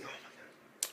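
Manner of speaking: breathless whisper of disbelief; whispered oh my God. Real, not acted. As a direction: gasp the words under your breath. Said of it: "And I'm like".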